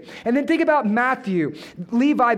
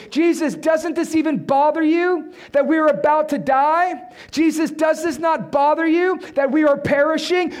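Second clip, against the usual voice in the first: accusing shout; 6 semitones higher, 4 dB louder than usual. Acted. Disciples accusing Jesus in storm.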